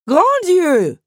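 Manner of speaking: high, loud theatrical cry; scandalized outburst; shocked exclamation. A narrator's reading. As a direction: exclaim in alarm, voice leaping up. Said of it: "s'exclama la comtesse".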